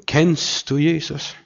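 slow question that touches a sore spot; slow, probing question. Retold or imitated. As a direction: let each word sink in.

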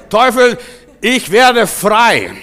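loud, high proclamation of freedom; defiant shout. Acted. Believer declares freedom to the devil, defiant shout. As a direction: shout it out with full conviction.

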